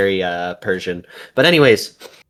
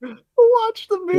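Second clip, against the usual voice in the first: much higher squeaky parody; echoing a friend in a much higher mock voice; mock squeaky repeat. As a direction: squeal it back mockingly. Mocking friend's repeated line.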